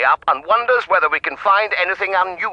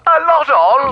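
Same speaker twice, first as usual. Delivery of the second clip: much higher, louder disbelief; incredulous echo; 12 semitones higher, 6 dB louder, slower than usual. Acted. Indignant expert echoing the detective, incredulous and loud. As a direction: throw his words back at him.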